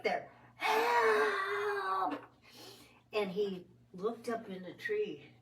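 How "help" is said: very high, crying shout; crying for help. Retold or imitated. Scared girl cries out to her dad below. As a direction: scream help down from the tree.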